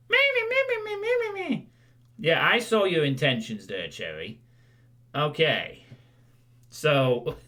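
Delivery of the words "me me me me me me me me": very high tiny creature squeak; squeaky pet voice. Pretend. Voicing pet Cherry squeaking.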